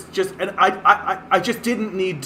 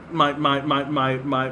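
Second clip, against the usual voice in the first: ordinary talk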